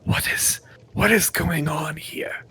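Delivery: soft, half-whispered character voice; hushed, bewildered question; Alabaster Cinder. Acted. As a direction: ask it under your breath, baffled. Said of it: "Alabaster Cinder has kind of made his way over".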